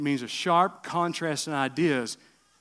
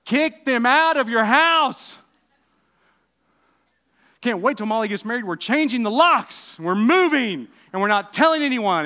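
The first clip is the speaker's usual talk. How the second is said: mock outburst; 8 semitones higher, 10 dB louder than usual. Preacher joking about grown kids.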